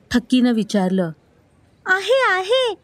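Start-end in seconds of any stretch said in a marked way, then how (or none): 1.8-2.7 put-on high-pitched child's protest; forceful insisting. A narrator's reading. Pundi insists that she has a mother, forceful protest. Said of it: "पुंडी जोरात म्हणाली".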